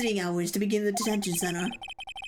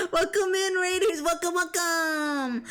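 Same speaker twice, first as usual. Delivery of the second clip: excited greeting; 7 semitones higher, slower than usual. Real, not acted. Streamer welcoming raiders to the stream, high excited hype. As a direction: throw the doors open for the raiders.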